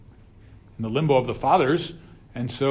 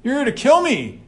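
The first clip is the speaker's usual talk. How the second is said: put-on much higher, panicky old witch voice; frightened witch confronting the king, high and panicky; shocked witch; 12 semitones higher than usual. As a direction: squeal it like a startled witch. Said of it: "She's like".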